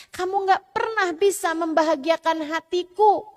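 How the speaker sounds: high, loud complaining wife's whine; nagging wife. Acted. Wife berating her husband, high and loud. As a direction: whine at him like a fed-up wife.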